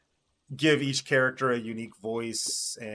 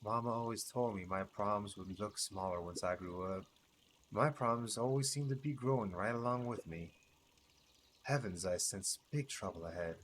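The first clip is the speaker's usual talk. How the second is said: gruff mutter; 3 semitones lower, 7 dB quieter than usual. Acted. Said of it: "muttered in a gruff voice".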